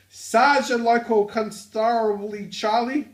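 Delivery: loud, high-pitched Australian; Australian accent. Acted. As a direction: belt it out in a broad, hammed-up Aussie accent. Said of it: "the work known as the Australian accent".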